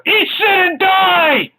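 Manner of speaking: high, drawn-out comic battle cry; mock shout. Comic duo punching a Spock fan, hammed-up shout. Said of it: "punch him in the face and shout".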